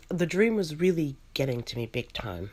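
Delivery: plain reading voice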